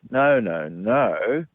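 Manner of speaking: singsong mock refusal; dismissive denial. People refusing to hear the cause, mock singsong denial. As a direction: swoop up and down on each no, imitating a denier.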